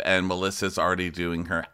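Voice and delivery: ordinary delivery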